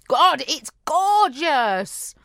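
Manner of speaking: slow, high, lingering relish; savouring gorgeous food; drawn-out savouring. Real, not acted. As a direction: stretch it out like tasting something divine. Savouring the cheese.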